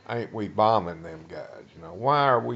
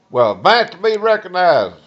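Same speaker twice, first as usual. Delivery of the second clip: with authority; 9 semitones higher, 9 dB louder than usual. Retold or imitated. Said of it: "he said it with authority".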